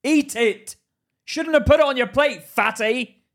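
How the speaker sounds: loud, high-pitched telling-off; mock scolding. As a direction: scold them loudly for wasting food. Mock scolding someone wasting food.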